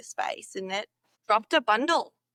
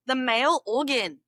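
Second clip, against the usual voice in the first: ordinary talk, nothing marked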